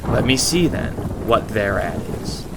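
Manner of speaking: plain reading voice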